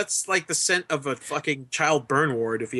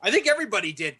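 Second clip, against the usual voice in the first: ordinary talk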